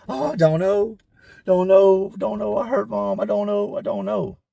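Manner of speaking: lightly voiced confused cellmate. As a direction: mumble it like the lost cellmate.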